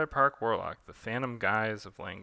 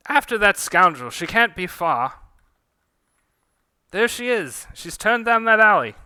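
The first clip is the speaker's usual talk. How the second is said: much higher, louder urgent shouts; shouting chase; 10 semitones higher, 15 dB louder than usual. Acted. Pursuers yelling after the thief, shouting.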